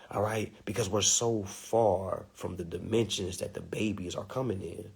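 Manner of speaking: hushed whisper, like a secret. Real, not acted. Comedian sharing a secret with the crowd, whispered. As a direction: lean in and whisper it.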